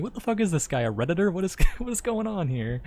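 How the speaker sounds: high-pitched, laughing incredulity; amused disbelief. Real, not acted. Streamer ridiculing a pompous game official, laughing disbelief. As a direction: break into laughter while ridiculing him.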